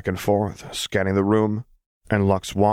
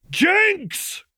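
calling out. A narrator's reading. Calling out sharply.

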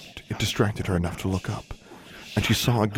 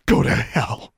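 voiceless, hissed fury; furious hiss; 3 semitones higher, 4 dB louder, slower than usual. A narrator's reading. Alexandra to the elf leader, hissing with fury. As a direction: hiss it out in rage. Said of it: "she hissed, shaking with fury".

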